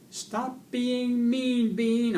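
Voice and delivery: high, loud, drawn-out squeaky yell; top-of-voice yell. A narrator's reading. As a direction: yell slowly with all your might. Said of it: "I yelled at the top of my voice".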